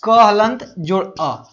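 क हलंत जोड़ अ said slowly, piece by piece; slow spelled-out breakdown. Shown as a demonstration. Teacher spelling the letter slowly for students, deliberate. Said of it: "यहाँ पे देखिएगा".

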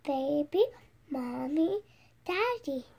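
ordinary delivery, nothing marked

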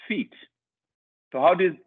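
normal talking voice

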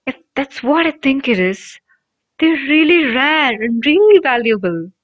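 awed whisper. A narrator's reading. Ron awed by cloak.

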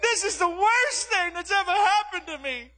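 high, hammed-up child's lament; mock bawling. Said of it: "she cried and she bawled".

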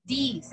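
"this" pronounced "these", long EE; plural form, long EE. Shown as a demonstration. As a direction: lengthen "this" to "deees". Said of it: "el que es plural". ("this" como plural, con I larga)

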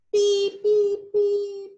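high loud beeping pip; little horn beeps. Acted. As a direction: beep like a tiny horn. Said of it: "gritó el camioncito azul". (pitido agudo)

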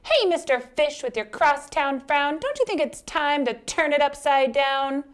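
high-pitched clam character voice; friendly advising clam. Acted. Clam advises frowning fish to cheer up, high voice. Clam's voice.

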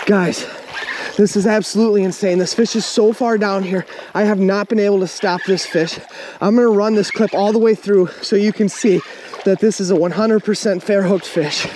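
ordinary talk

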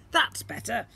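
booming slime creature. Acted. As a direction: play the magical blob, grand and eager.

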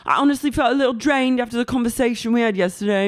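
high, fast imitation; put-on character voice. Acting out James approaching Raquel, put-on character voice.

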